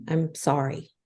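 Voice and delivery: short, grudging I'm sorry; curt apology. Shown as a demonstration. Wife showing a curt apology to husband. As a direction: toss off a bare sorry. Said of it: "Or I can just say".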